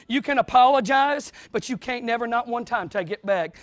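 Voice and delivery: ordinary talk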